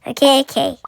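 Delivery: ordinary talk, nothing marked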